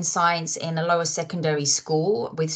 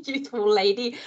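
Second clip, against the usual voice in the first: laughing; 7 semitones higher than usual. Real, not acted. Laughing at joke about age.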